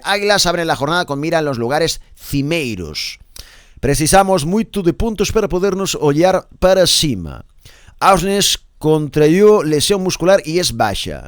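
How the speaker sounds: emphatic read in Portuguese-flavoured Spanish; projected press-review announcer, stretching the words; newspaper read. Acted. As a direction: declaim the Portuguese headlines word by word. Reads Portuguese paper aloud.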